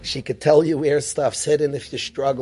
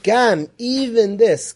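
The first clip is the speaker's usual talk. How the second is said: "Gam" drawn out slowly and higher, weighted; heavy stress on gam; 5 semitones higher, 4 dB louder, slower than usual. Shown as a demonstration. Teaching students the weight of gam, slow and stressed. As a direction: lean hard on "Gam, even this". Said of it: "The word gam suggests that I'm struggling".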